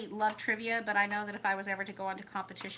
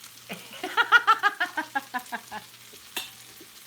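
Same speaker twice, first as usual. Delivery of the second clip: long, high, loud burst of laughter; hearty laugh; 7 semitones higher, 4 dB louder than usual. Real, not acted. Cracking up with sister over the accent joke, hearty laugh. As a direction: laugh hard, then let it tail off.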